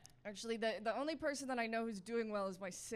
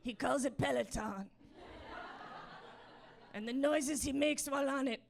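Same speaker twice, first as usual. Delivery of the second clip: goblin voice. Acted. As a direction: talk like a baffled little goblin in broken sentences. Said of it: "the goblin that's running on a treadmill in my head".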